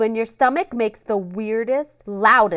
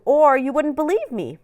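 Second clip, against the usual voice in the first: ordinary delivery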